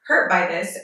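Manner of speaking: ordinary talk, nothing marked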